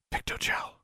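barely audible voiceless whisper; very quiet incantation. A narrator's reading. Girl casting a secret spell, whispering. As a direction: breathe the spell as softly as possible. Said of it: "spoke the incantation as quietly as possible".

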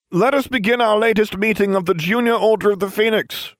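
pompous chairman opening the meeting; grand declaration. Acted. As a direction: announce it like a self-important chairman. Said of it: "I declared".

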